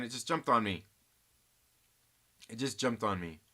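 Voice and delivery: normal talking voice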